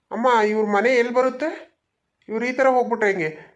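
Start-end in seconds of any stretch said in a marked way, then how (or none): none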